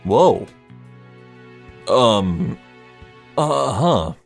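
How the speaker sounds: normal talking voice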